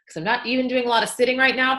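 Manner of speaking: ordinary talk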